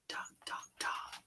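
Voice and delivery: tiny whispered taps; soft whisper. A narrator's reading. Narrator whispering the eggs tapping. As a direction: tap it out softly.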